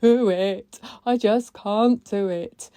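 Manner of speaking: slow, tearful-sounding whimper; retold crying. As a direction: replay the despair, slowly. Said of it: "And I went".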